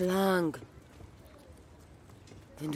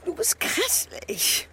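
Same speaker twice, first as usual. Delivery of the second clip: shocked exclamation; 5 dB louder, slower than usual. Acted. Bichette exclaims at her lover.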